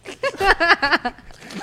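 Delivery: risa real muy aguda; risa incontenible. Real, not acted. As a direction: suelta una risa aguda, sin subir el volumen. Laughs at being put on spot. (risita aguda y prolongada)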